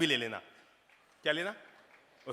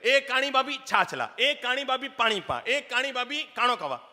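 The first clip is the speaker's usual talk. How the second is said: mocking teaser; 8 semitones higher, 6 dB louder than usual. Bride mimics teasing brother-in-law.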